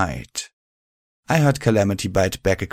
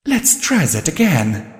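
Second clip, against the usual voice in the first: higher, slow, cool mare; Velvet voice; 8 semitones higher, 3 dB louder than usual. A narrator's reading. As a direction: say it with calm composure. Velvet composed.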